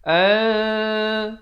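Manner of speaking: "An" chanted high with a hidden nasal hum; veiled two-beat N. Shown as a demonstration. Teacher showing ikhfa, "An" held nasally. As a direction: hold "An" two beats without closing on n. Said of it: "Dengan samar dua harakat".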